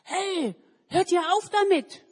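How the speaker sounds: loud, high scolding shout; angry neighbour woman shouting at the kids; woman yelling. Acted. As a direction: stop them with a yell. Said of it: "schreit eine Frau".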